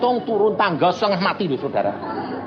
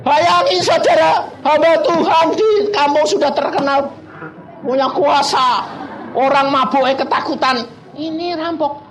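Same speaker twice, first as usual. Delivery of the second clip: ordinary talk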